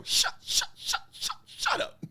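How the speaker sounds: breathy shushes that jump to a high squeak on the last shut up; mock shushing. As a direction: hush the camera, then squeak. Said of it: "I'm just, every video".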